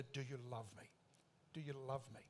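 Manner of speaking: quiet, low and gentle; tender question. Retold or imitated. Jesus gently asks Peter about his love. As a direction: ask it softly, close and personal.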